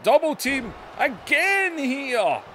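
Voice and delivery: drawn-out high shouted call; excited wrestling commentator. Real, not acted. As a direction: call the double team at ringside. Double team during match call.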